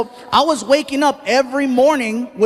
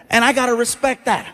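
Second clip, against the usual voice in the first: ordinary talk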